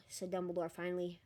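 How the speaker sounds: plain reading voice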